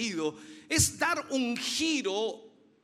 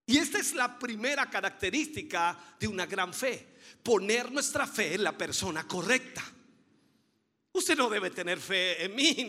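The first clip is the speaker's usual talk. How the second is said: normal talking voice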